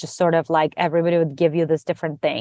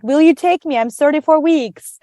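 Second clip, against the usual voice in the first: nervous; 7 semitones higher, 4 dB louder than usual. Retold or imitated. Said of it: "was really scary".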